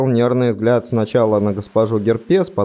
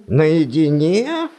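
slow puzzled rising наедине; surprised question; 6 semitones higher, much slower than usual. Acted. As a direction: echo it back in surprise. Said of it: "удивился профессор".

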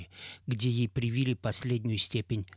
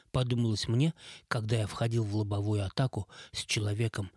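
plain reading voice

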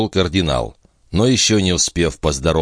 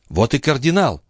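raised-pitch princess exclaiming; mild exclamation; 7 semitones higher than usual. A narrator's reading. The princess hears the cardinal's carriage arrive, exclaiming. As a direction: exclaim it high, without extra volume. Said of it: "воскликнула принцесса".

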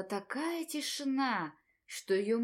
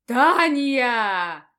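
thundering shout; 9 dB louder than usual. Acted. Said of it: "Голос грянул, как гром с поднебесья".

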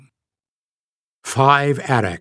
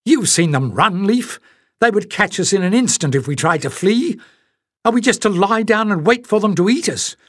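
angry Jasmine; 8 semitones higher than usual. A narrator's reading.

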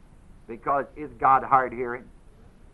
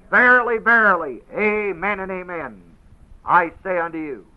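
louder, higher, emphatic; solemn declaration; 6 semitones higher, 8 dB louder than usual. Retold or imitated. Jesus solemnly declares to Nathanael, emphatic and louder. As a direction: declare it with weight. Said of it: "he saith unto him".

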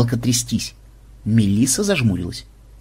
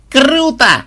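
high loud cry of glee; delighted yell; 12 semitones higher, 5 dB louder than usual. Acted. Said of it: "в полном восторге завопила внучка". (восторженный вопль)

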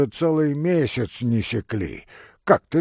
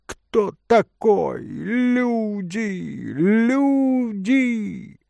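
high, drawn-out cry for help; old man shouting; 9 semitones higher, 3 dB louder, much slower than usual. A narrator's reading. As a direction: bellow for the servants, stretching the vowels. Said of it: "закричал старик". (протяжный крик старика)

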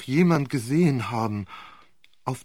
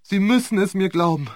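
desperate plea; 7 semitones higher, 5 dB louder than usual. A narrator's reading. Repeated plea, building.

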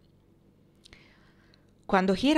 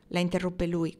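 usual speaking voice

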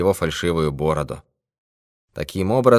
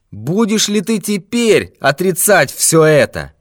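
a louder, higher, menacing villain voice; menacing thief; 6 semitones higher, 3 dB louder than usual. Acted. Thief accuses old miser of hidden gold, menacing. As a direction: corner him with every word.

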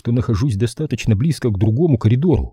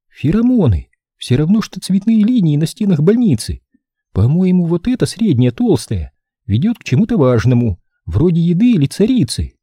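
higher, lightly voiced woman; female character voice; 7 semitones higher than usual. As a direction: explain it like a curious scout. Jid explains the pheromone trails.

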